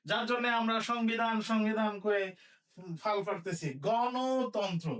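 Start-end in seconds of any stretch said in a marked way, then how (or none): 3.9-4.9 গণতন্ত্র stretched out very slowly; teacher dictating slowly; slow dictation. Shown as a demonstration. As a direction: draw out গণতন্ত্র syllable by syllable for writing. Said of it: "কি লিখবো?".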